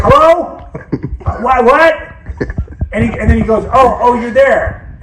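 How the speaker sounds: high, loud bewildered voice, slowing down at the end; a puzzled old man who hears nothing; confused dad. Retold or imitated.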